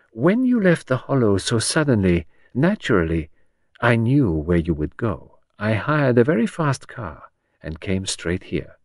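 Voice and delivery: unhurried, self-assured Belgian detective; accented detective. Acted. Poirot explains to Henrietta how he followed, measured and accented.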